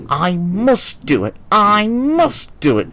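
slow, high, strained effort; straining. A narrator's reading. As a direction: force the words out uphill. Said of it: "he strained".

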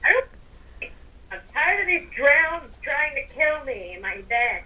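ordinary delivery

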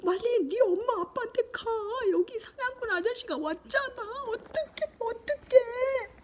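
high, alarmed wail, tearful at the end; panicked plea. Acted. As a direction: cry out in mock panic for the child to flee. Acting out alarm at the hunter. (겁에 질린 높은 목소리로 울먹이듯)

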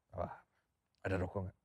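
low, quiet, casual request; retold cool-guy understatement; acting cool. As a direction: ask offhandedly, playing unshaken. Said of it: "gue cool banget saat itu".

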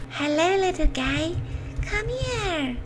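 soft, high coaxing coo; sweet-talking animal. Pretend. Player luring a boar, cooing softly. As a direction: lure it in gently.

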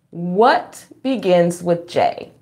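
ordinary talk, nothing marked

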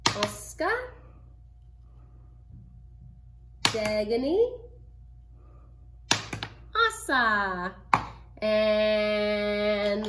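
put-on bright counting for kids; kids show host.

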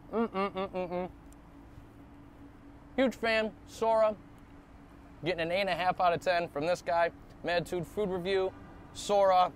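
chewing while humming; talking between bites of sushi; mouth full. Real, not acted. Seen 2: bite taken at 0.2; closed, working mouth while humming.

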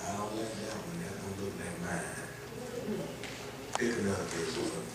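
quiet aside. Real, not acted. Aside to helper, off mic.

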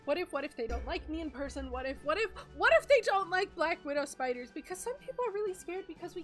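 high, loud anxious rush; nervous spider fretting about meeting a friend; panicked worrying. Acted. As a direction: spiral into nervous what-ifs. Sky panics aloud.

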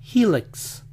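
"Helix" as "HEE-lix"; high first syllable on "Helix", E as I; American "Helix"; English pronunciation. Shown as a demonstration. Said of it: "las vocales se hubieran cambiado de posición".

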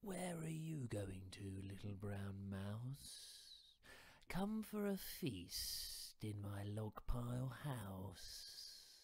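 slow, drawn-out sly snake voice; slithery snake. Acted. Snake inviting the mouse to a feast, slow and sly.

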